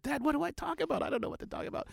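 put-on high, panicky young man asking his father; a jittery son begging his dad for date advice; nervous son. Nervous son pleading with his dad before a first date. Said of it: "He said".

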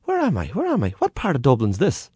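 put-on high bewildered old man; confused stowaway. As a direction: ask in a daze. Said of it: "he said".

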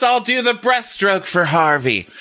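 high, slow announcement to the group; hammed-up pool leader voice; mock rallying call. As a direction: call everyone to a silly tribute. Acting out the tribute at pool.

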